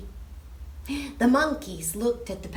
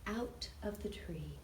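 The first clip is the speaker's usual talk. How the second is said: hushed storytelling; 2 semitones lower, 6 dB quieter than usual. A narrator's reading. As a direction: draw the children in with a hush. Hushed climax of story time.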